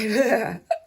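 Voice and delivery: a short real laugh with tears in it; teary laugh. Real, not acted. Laughing through tears on stream.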